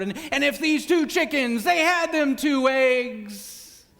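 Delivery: preaching math like a revival sermon; evangelist preacher. Pretend. Teacher preaching math to students as an evangelist. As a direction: thunder it out like an evangelist at the pulpit. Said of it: "role-playing as if an evangelist was teaching math".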